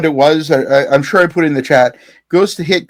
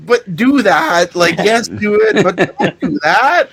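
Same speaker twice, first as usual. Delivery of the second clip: laughing egging on; 4 semitones higher, slower than usual. Retold or imitated. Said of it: "And I'm like".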